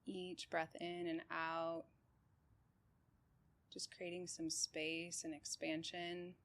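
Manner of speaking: calm, even meditation guide with long pauses; unhurried body-scan voice, settled and steady; guided meditation. Real, not acted. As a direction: guide them gently through breath and body, leaving space between phrases.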